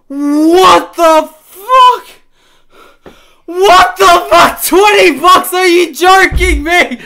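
drawn-out stunned yell; shocked shouting. Real, not acted. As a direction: shout in disbelief. Disbelief at donations.

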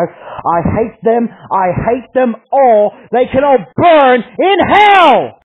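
slow, rising into a high, loud, hate-filled yell; hateful rant. Real, not acted. Ranting hatred at the readers.

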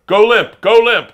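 loud, high trainer's command; urgent shouted order. Retold or imitated. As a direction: shout it urgently over the chaos. Trainer shouting orders during attack.

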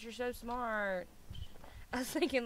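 ordinary delivery

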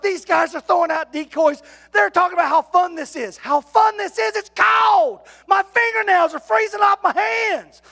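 high, loud, exasperated; exasperated shout. Retold or imitated. Preacher griping to the church about the freezing hunt, loud and high. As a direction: shout out the misery of the cold.

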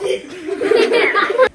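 giggling burst; laughing. Real, not acted. Laughing after line.

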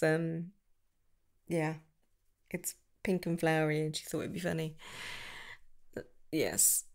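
ordinary talk, nothing marked

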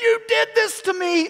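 hammed-up blaming yell; mock angry shout. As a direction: yell it like a furious wife in labor. Wife blaming him in labor.

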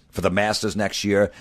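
ordinary talk

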